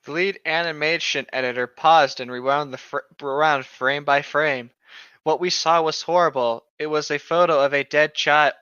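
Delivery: usual speaking voice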